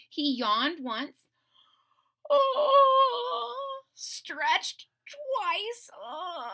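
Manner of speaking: high groaning stretch sounds; yawning. A narrator's reading. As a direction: yawn and stretch through the words. Narrating dragon's yawn and stretch.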